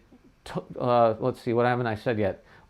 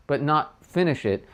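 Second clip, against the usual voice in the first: ordinary talk, nothing marked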